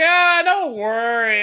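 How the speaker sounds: loud, slow and carefree; carefree Goku. Retold or imitated. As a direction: brush it off loudly, no worries. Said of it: "And Goku's like".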